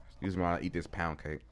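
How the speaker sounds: eating while talking; mouth full. Real, not acted. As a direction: talk through a mouthful. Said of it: "I'm talking with my mouth full".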